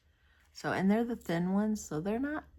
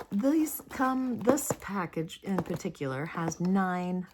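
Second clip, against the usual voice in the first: ordinary talk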